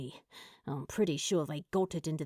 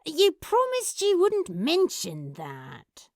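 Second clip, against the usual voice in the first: betrayed; 8 semitones higher than usual. Acted. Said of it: "Crowley's expression of betrayal".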